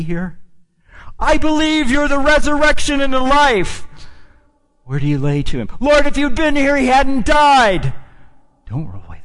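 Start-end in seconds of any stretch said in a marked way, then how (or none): 1.2-3.9 high, loud pleading woman's voice; Martha's plea. Retold or imitated. Martha voiced in preacher's retelling. 5.8-7.9 high, loud pleading woman's voice; Martha's plea. Retold or imitated. Martha voiced in preacher's retelling.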